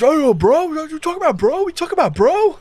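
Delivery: bro dudes. Acted. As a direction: ham up the cocky bros squaring off.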